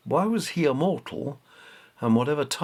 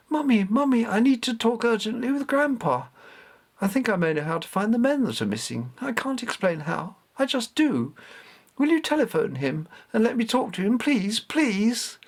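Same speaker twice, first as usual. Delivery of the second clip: put-on much higher, urgent young boy's voice; imploring child; 11 semitones higher than usual. A narrator's reading. Richard begging his mother to phone Grandpa, imploring. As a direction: beg your mother with breathless urgency. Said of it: "her son's imploring voice".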